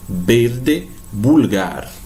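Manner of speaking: "verde, vulgar" starting with B sounds; Spanish V as B. Shown as a demonstration. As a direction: say "verde, vulgar" as "berde, bulgar". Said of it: "sempre igual ao nosso B". ("verde, vulgar" com V soando como B)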